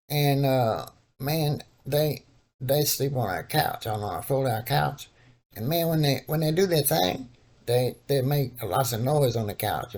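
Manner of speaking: prank caller in a put-on old man voice; disguised voice calling the cousin about the couch.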